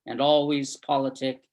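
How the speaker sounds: projected, deliberate verse recital; poem reading. Acted. Reader shares a Heaney poem as tribute to lost friends, projected recital. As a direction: read the poem out firmly, phrase by phrase.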